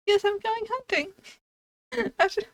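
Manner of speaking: high, giggly reading; laughing. Real, not acted. Streamer playing on after a laughing fit, still giggling. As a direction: try to carry on reading through real laughter.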